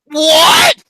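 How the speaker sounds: shocked, loud, high cry; outraged shout. Real, not acted. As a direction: yell it in disbelief. Outraged at movie ranking.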